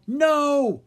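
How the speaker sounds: high loud cry of no; sharp anguished shout. Acted. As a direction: protest in horror. Drake protesting the attack.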